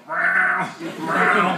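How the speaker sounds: loud, high meowing; imitated cat meow. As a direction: yowl like a demanding cat. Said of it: "proceeds to stare at it and go".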